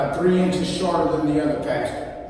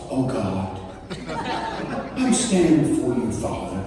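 a touch of hammed-up piety; mock solemn prayer; 6 semitones lower, 4 dB quieter than usual. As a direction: pray lower, overly solemn, mocking it. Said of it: "trying to get all serious with God".

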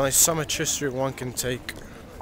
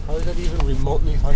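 fast, louder disbelieving challenge; incredulous; 2 semitones higher, 6 dB louder, faster than usual. Real, not acted. Vlogger challenging Monica's joke, fast and incredulous. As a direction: demand to know why that's funny. Friend mocks the unfunny joke.

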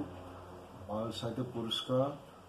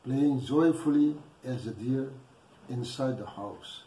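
louder, measured poetry reading with pauses; poem recitation; 2 semitones higher, 7 dB louder than usual. Acted. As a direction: declaim the poem phrase by phrase, projecting firmly. Recites own poem after introduction.

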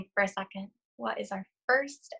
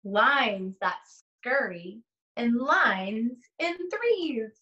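put-on slow, sing-song read-aloud to young children; lingering on each rhyme, long pauses between pages; story read aloud; 3 semitones higher, much slower than usual. Reads picture book aloud slowly.

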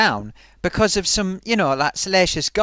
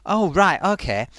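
ordinary talk, nothing marked

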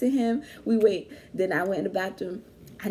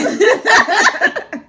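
high, loud laugh; 11 semitones higher, 12 dB louder than usual. Real, not acted. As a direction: burst out laughing. Laughing at boyfriend's question.

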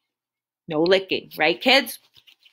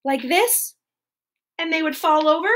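plain reading voice

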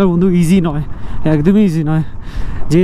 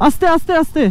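calling out; 8 semitones higher than usual. Real, not acted. Calling to rider on gravel road.

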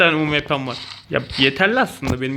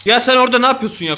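exasperated shout; 6 semitones higher, 6 dB louder, faster than usual. Real, not acted. Shouting at enemy in game.

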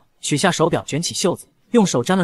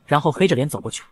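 ordinary delivery, nothing marked

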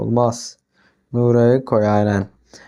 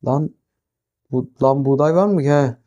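ordinary delivery

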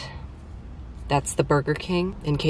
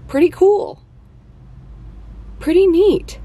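high, loud, cartoonish delight; a high loud playful voice for a silly aside; goofy excitement; 14 semitones higher, 4 dB louder than usual. Real, not acted. Goofy aside about Lake Michigan.

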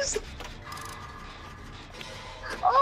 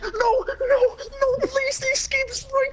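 desperate pleading; 9 semitones higher, slower than usual. Real, not acted. Desperate near the exit.

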